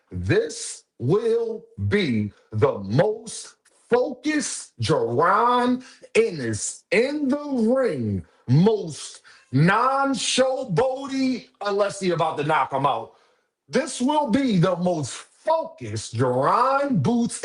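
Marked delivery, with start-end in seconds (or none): 0.1-11.5 very slow and much higher, words stretched; drawn-out prophecy. Real, not acted. 13.7-17.4 very slow and much higher, words stretched; drawn-out prophecy. Real, not acted. Repeats prophecy slowly.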